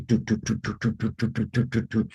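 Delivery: soft, even run of "doo" heartbeats; imitated pounding heartbeat. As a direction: mimic a racing heart with quick, soft doos. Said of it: "my heart started pounding".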